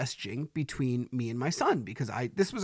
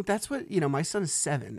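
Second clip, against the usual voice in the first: ordinary talk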